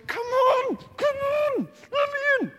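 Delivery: very high, whining "come on, let me in"; escaped prisoner whimpering at the safe-house door; desperate plea. Acted. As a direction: beg to be let in, near panic. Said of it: "You'll, you'll go".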